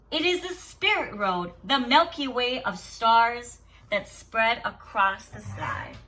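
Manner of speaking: steady storybook reading to a class; story read aloud. Acted. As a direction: read the tale calmly to the children. Teacher reads story aloud.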